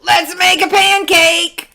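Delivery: high, loud excited shout; eager shout. A narrator's reading. Jack begging his mother for a pancake, shouting. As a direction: belt it out like an impatient boy. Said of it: "shouted Jack".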